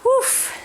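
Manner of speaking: sharp high woof of relief after effort; effortful woof exhale. Real, not acted. Exhale after a hard set.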